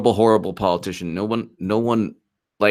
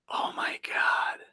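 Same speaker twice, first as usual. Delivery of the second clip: whispered; 11 dB quieter, slower than usual. Real, not acted. Dismay at polls.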